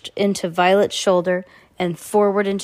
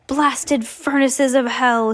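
drawn-out, higher-pitched cry of rage; narrated angry outburst; angry shout; 5 semitones higher, slower than usual. A narrator's reading. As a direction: curse it slowly in understated rage. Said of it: "he shouted".

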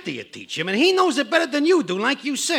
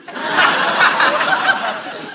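mocking laugh; 12 semitones higher than usual. Coworker laughing at Riley's bad luck. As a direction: laugh at him, enjoying it.

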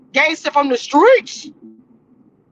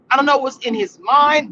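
ordinary talk

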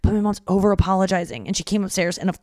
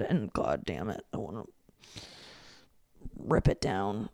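muttered anger; 10 semitones lower, 11 dB quieter than usual. Real, not acted. Angry at new neighbor flag.

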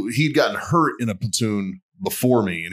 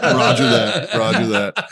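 loud, laughing acknowledgement; 4 dB louder, slower than usual. Retold or imitated. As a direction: chuckle through it. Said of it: "they're like".

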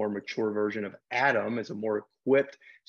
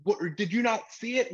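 mock coach yelling; 8 semitones higher than usual. Angry coach protesting a missed call to the referee, mock yell. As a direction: play the upset coach barking at the ref. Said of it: "So coach is yelling".

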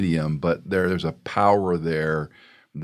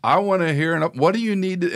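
high, insistent demand; a father's high, insistent push for an apology; stern father; 11 semitones higher than usual. Retold or imitated. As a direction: keep pushing for the apology.

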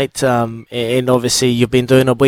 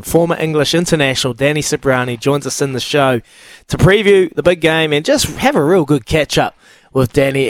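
ordinary talk

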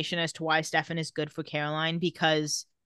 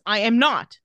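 loud, higher-pitched mock-angry retort; angry denial; 4 semitones higher, 6 dB louder than usual. Caroline insisting to Stefan she isn't angry, acted angrily. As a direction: deny it hotly, as if quoting. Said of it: "But she says it angrily".

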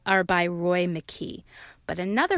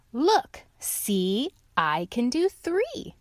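excited lion showing off; lightly voiced bragging lion; proud lion; 4 semitones higher, much slower than usual. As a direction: show off your trick, eager. Lion boasts.

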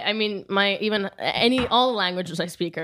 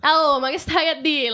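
put-on Italian accent; 8 semitones higher, 4 dB louder than usual. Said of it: "And I'd be like".

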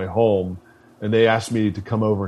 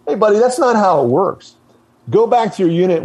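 higher, louder mock dismissal; retold instructor scolding; 9 semitones higher, 3 dB louder than usual. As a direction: send the cocky lieutenant away like an irritated instructor.

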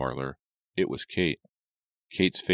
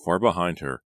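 usual speaking voice

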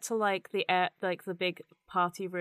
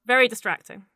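ordinary delivery

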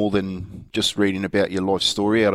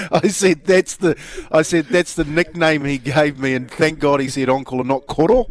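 higher, louder, laughing through it; explaining the nickname to the fan, laughing; laughing explanation; 5 semitones higher, 4 dB louder than usual. Retold or imitated. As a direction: chuckle while explaining the nickname.

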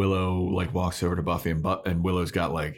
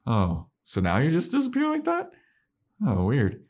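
high mocking voice; 8 semitones higher than usual. Buffy voiced in retelling.

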